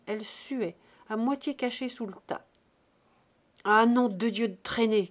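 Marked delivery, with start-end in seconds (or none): 3.7-5.1 shouted, much louder, furious at her delay; cri furieux. A narrator's reading. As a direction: bark the curse at the late girl. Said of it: "cria Buteau". (cri furieux de Buteau)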